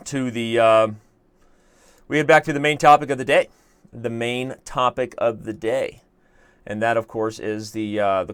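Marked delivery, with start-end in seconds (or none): 2.1-3.5 quick, loud, higher delivery; fast and raised, lifting at the end; brisk announcement. Shown as a demonstration. Announces topic, then repeats it slower. 3.9-6.0 slow, measured delivery; unhurried, weighty repeat at normal pitch; deliberate restatement. Shown as a demonstration.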